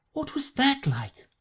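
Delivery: put-on light, high child's question; high-pitched girl. A narrator's reading. Pupil's listener asks about the lesson, high and curious.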